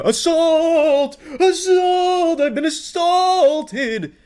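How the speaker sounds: high-pitched, hammed-up wailing; mock scream. Commentator imitating people who cry assault when bumped, mock scream. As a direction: stretch it out in an exaggerated squeal. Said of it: "people that just scream".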